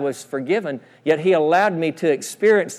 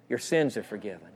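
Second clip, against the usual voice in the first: hushed, low, tender assurance; gentle reassurance; 8 semitones lower, 11 dB quieter than usual. Acted. Preacher voicing what the man needed to hear, gentle reassurance. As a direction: drop low and soft to absolve. Forgiveness repeated softly.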